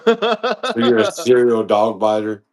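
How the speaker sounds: slow, laughing through the question; amused disbelief, laughing as he asks; laughing out the words serial dog biter. Real, not acted. Laughing at the dog-biting claim.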